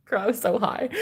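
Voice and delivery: normal talking voice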